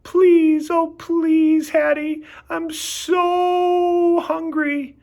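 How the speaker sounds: high, slow, begging whimper; whimpering plea.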